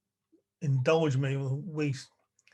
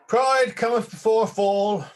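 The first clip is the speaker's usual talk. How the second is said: loud, raised proclamation; higher, ringing proverb delivery; mock-solemn declamation; 7 semitones higher, 7 dB louder than usual. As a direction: declaim it like a warning from the pulpit. Declaims a proverb after the poem.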